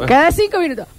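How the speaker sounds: usual speaking voice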